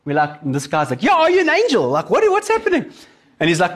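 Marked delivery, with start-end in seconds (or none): 1.0-2.9 high, louder astonished question; amazed disbelief. Retold or imitated. Driver to the sudden paramedic, high amazed disbelief. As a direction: gasp it out like he's seen an angel.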